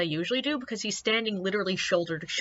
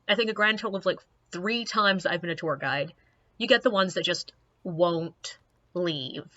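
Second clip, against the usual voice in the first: normal talking voice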